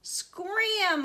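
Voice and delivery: high, loud holler; shrieked, drawn-out order to get away. A narrator's reading. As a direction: holler it to scare off birds. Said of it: "hollered Grandma".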